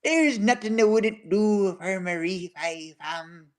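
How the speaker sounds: slow, lightly put-on Irish accent. Retelling a friend's words in a mild Irish accent. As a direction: quote the friend in a gentle Irish lilt. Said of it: "In the peak Irish accent".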